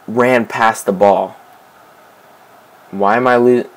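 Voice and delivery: normal talking voice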